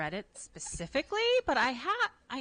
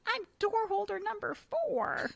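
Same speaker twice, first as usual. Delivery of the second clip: put-on much higher, slow, laughing kid's voice; proud little kid; slower than usual. Retelling the TikTok kid's play role, high slow laughing voice. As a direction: squeak it out like a proud toddler.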